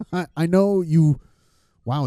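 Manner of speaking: usual speaking voice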